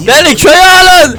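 high, loud calling out; mock plea. Gamer calling out to the village to let him in, loud. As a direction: call out to be let in.

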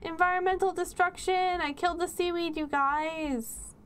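high cheer easing off toward the end; mock celebration. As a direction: crow in mock triumph, gradually settling. Repeats the mock celebration to viewers.

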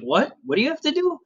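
baffled office guy. Retold or imitated. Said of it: "he looked at me like".

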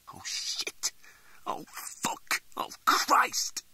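panicked whispered curses; terrified whisper. Acted. Frozen in bed seeing his father, terrified whisper. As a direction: gasp the oaths in terror.

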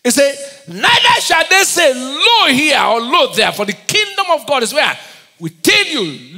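loud, high sermon cry; full-force preaching. Acted. Preacher shouting scripture to the church, loud and high. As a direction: thunder the scripture at the congregation.

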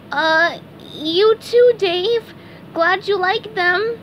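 high, slow, hesitant cartoon voice; sheepish high-pitched character; SpongeBob, awkward. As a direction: drag out an embarrassed reply up high. Embarrassed SpongeBob answers Dave.

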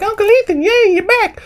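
put-on very high squeaky child voice; little children. Children greeting Uncle Ethan back from war, high mock child voice. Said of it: "all the little children like".

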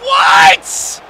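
very high, loud yell of shock; shouted disbelief. Real, not acted. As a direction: scream it in real disbelief. Gamer shouts at a goal conceded.

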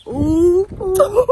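high, loud delighted ooh; excited squeal. Real, not acted. Showing off new lashes.

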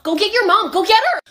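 high, loud urgent order; frantic teen. Acted. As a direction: shout it at your friend in panic. Teen urgently sends friend for money.

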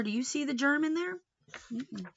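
plain reading voice

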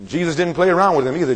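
ordinary talk

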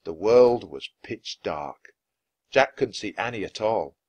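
usual speaking voice

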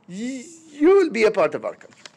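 lightly voiced German accent, a touch stern; hint of German accent; mock German. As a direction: clip it like a mock German officer. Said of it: "Germany came in and they were like".

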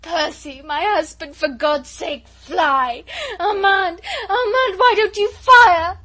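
high, loud sobbing cry; sobbing shriek. Acted. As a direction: cry out in despair, voice breaking. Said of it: "half sobbing, half shrieking".

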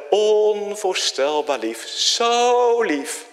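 high, slow marvelling; hushed wonder at God's love; awed tenderness. Acted. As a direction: let the wonder swell.

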